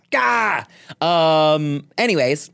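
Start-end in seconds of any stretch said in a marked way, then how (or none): 0.1-0.6 caveman grunt. Pretend. Caveman bit ends in a shout.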